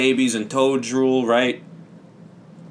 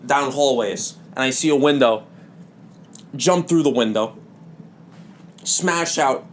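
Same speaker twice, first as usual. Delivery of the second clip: ordinary talk, nothing marked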